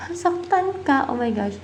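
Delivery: high-pitched startled reaction; shocked realisation. Real, not acted. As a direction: react in real surprise to bad news, voice jumping up. Shocked at what the cards answer.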